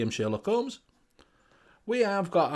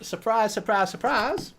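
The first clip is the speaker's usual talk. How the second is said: put-on Liverpool accent; 4 semitones higher, slower than usual. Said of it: "he can't do a Liverpool accent".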